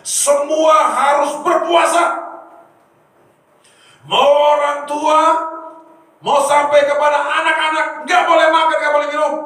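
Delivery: a king booming out a royal decree; king's command. Retold or imitated. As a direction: order the whole nation to fast. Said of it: "dia perintahkan".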